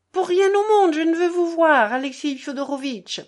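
put-on high, loud girl's refusal; crying out. A narrator's reading. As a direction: shout it through the door, stubborn. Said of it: "s'écria Lise". (s'écrier d'une voix aiguë et forte)

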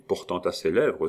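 ordinary delivery, nothing marked

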